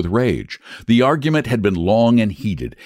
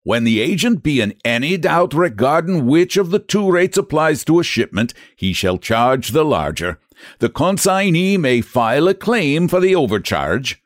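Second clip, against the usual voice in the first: higher, smug Irish clerk; stubborn Irish agent, self-satisfied; Irish brogue; 6 semitones higher than usual. Acted. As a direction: read the rulebook like gospel, Irish. Flannery reads rules in brogue.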